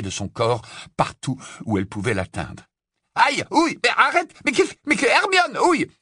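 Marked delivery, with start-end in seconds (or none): 3.1-5.9 high, yelped protests under blows; cris de douleur. Acted. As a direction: cry out while being pummelled. Ron roué de coups proteste. (protestations criées sous les coups)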